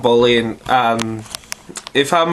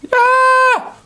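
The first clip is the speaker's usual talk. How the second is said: excited squeal; 3 dB louder than usual. Real, not acted.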